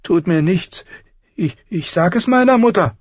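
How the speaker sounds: hoch, stockend und ängstlich; flehend. A narrator's reading. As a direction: droh kläglich mit der Mutter. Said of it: "flehte er". (der Prinz fleht Arya an und droht ängstlich)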